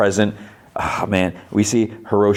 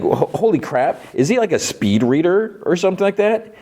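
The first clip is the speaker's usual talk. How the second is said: incredulous; 10 semitones higher than usual. Real, not acted. Disbelief at character speed reading.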